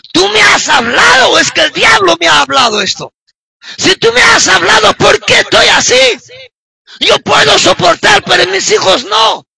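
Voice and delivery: high, impassioned shouted complaint; retold protest to God. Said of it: "de esa manera que tú puedas reclamar". (reclamo apasionado a Dios, a gritos)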